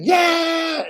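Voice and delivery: high, loud metal-singer yell; imitated rock scream. Recruit recalling the blasted wake-up music, imitated rock yell. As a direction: burst into a mock rock shout.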